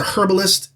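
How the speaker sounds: "herbalist" with a sounded H; hard H. Retold or imitated. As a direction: imitate the narrator's H at the start of "herbalist". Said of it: "that's how Veraces reads the lines".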